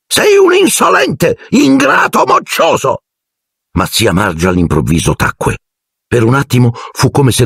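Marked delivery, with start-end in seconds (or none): 0.0-3.0 very high, slow shrieked insults; raging aunt. A narrator's reading. Aunt Marge screams insults at Harry. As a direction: hurl each word at the boy.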